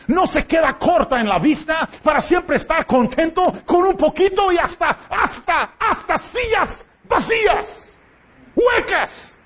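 preacher's pitch climbs into a cry over the empty chairs; rising shout. Real, not acted. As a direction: build the rebuke to a high cry.

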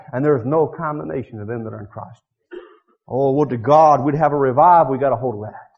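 ordinary delivery

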